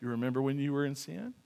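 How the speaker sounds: low, soft, coaxing whisper of the devil; tempting devil. Pretend. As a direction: lure them back like a sly tempter.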